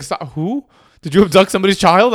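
lightly hammed-up disbelief; mock alarm. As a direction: act out a startled partner's questioning. Retold partner's alarmed reaction.

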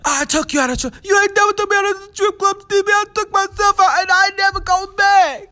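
shrieking reality-TV diva voice; Jocelyn Hernandez impression. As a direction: scream it like a furious reality star. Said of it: "That's my Jocelyn Hernandez impression".

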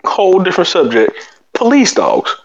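ordinary talk

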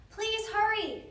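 high, loud pleading shout; urgent shout. A narrator's reading. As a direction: shout to hurry someone along. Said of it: "shouted Annie".

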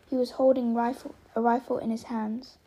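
usual speaking voice

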